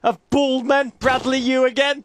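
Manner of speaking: high, commanding locker-room speech; single out players like a coach; mock team talk. Coach calling out players by name, hammed-up rallying. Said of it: "Here's what I'd say if I were Jurgen".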